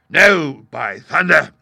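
booming, emphatic pirate oath; thunderous, defiant shout; loud cry. A narrator's reading. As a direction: roar the refusal at a mutinous crew. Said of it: "he cried".

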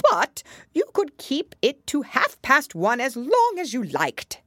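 loud, high, grandly explaining Hatter; animated Hatter. A narrator's reading. Hatter telling Alice what Time could do, animated. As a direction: build with relish to a high, loud finish.

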